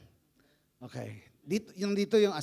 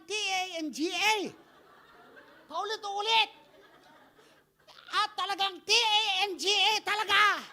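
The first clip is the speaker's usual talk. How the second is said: high, hammed-up scolding wife voice; shrill-free but high mocking wife spelling out the insult; mock nagging wife; 9 semitones higher than usual. Retold wife to apostle, a high mock scolding.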